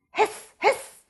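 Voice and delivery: hissing loud like an angry goose. A narrator's reading. Goose hisses angrily at the fox in the trap. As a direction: spit the hiss out loud. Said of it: "went the angry goose".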